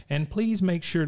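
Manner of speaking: high, pious prayer voice; mock Luann prayer. Luann prays at dinner, high mock-pious plea. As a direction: pray it sweetly. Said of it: "she's obviously praying".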